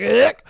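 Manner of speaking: "Ick" punched out loud; disgusted inflection. Shown as a demonstration. Host imagining himself in the ladies' situations, loud disgusted "Ick". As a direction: fling out "Ick" to repel someone. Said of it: "I'd be like".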